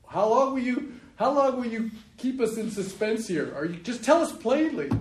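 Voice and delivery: high, loud mock of the impatient crowd; impatient demand. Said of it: "He's, he's like, like".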